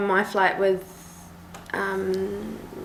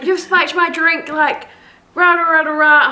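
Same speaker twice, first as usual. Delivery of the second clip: mock blather getting louder; mock drunk rant; 7 semitones higher, 4 dB louder than usual. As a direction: ham up the ranting passenger. Imitating passenger ranting.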